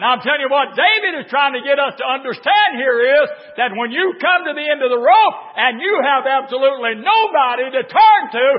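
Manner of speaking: loud, high preacher's cry; full-throated revival preaching; preaching at full force. Acted. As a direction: build the congregation up, shouting.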